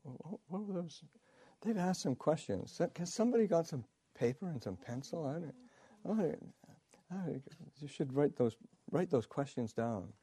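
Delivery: lightly voiced old teacher, teasing; mock Ajahn Chah. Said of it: "He said".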